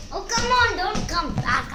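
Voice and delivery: loud, annoyed yell at an object; exasperated shout. Real, not acted. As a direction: shout at it to stay away. Object rolling back toward her.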